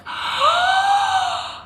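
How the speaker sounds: very high imitated shocked gasp. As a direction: mimic the friend's shocked gasp. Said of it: "her face was like".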